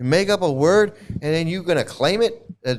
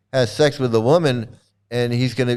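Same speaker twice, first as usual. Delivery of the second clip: ordinary talk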